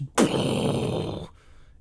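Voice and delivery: loud, long blown-out boom; mouthed explosion noise. Pretend. Speaker imitates the slammed door exploding, mock blast.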